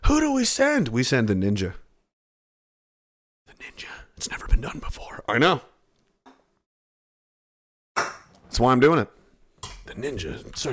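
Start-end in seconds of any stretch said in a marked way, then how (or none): 3.5-5.2 whisper. Pretend. Whispered dramatic aside.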